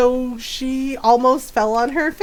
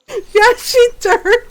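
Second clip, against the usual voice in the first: laughing; 8 semitones higher, 3 dB louder, slower than usual. Real, not acted. Laughing retelling of daughter's fall.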